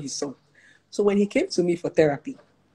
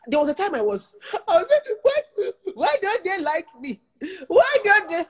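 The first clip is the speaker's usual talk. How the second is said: laugh-crying lament; 12 semitones higher than usual. Retold or imitated. Retelling own tearful past lament.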